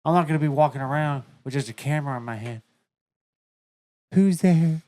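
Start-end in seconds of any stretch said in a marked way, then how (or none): 4.1-4.8 calling out nervously into the dark; scared horror movie victim. Retold or imitated. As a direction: play the doomed character peeking around. Mocking a horror movie character.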